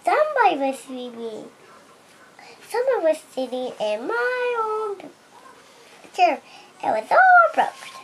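little bear voice, climbing very high; Baby Bear. Acted. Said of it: "Baby Bear said".

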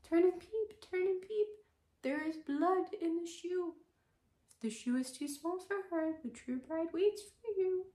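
high chanting pigeon rhyme; pigeons crying out. Acted. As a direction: pitch way up like a cooing pigeon. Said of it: "the two little pigeons sat and cried".

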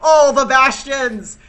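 slow, high, loud cheer; drawn-out gleeful yell; excited shout. Real, not acted. Whole team picks Bastion.

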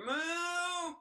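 a high, bright mock moo; a playful high moo to open the intro; high cow moo. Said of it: "then you can say, like, "moo".

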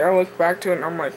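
usual speaking voice

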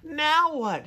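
high-pitched call back. A narrator's reading. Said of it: "he called back from inside his shell".

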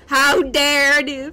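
loud, slow mock indignation; hammed-up offended gasp of outrage.

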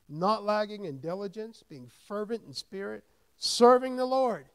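preacher proclaiming the verse to the church; building scripture proclamation. Acted. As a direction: rise until you shout the last words. Reads scripture, rising to shout.

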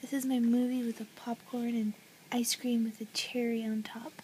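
soft tender whisper. Real, not acted. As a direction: breathe it lovingly. Whispering over the baby.

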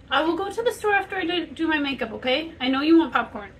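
loud, high, fast call to the next room. Real, not acted. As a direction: shout it through the door. Calling out to kid about popcorn.

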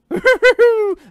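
very high, loud imitation hoot; mimicked character noise. Gamer imitating how the game rider sounded, mimicked noise. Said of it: "that's how he sounded".

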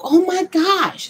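high, slow gushing stranger voice. Retold or imitated. Strangers staring at the sisters, high and gushing. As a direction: gush like an amazed passerby.

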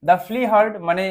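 ordinary talk, nothing marked

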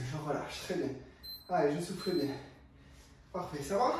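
ordinary delivery

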